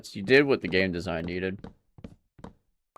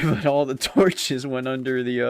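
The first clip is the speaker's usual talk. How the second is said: ordinary delivery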